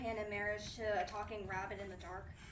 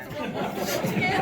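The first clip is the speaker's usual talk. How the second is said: loud, slow, laughing reveal; triumphant shout; 5 semitones higher, 11 dB louder, slower than usual. Acted. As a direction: blurt the name out in triumph. Triumphant loud name guess.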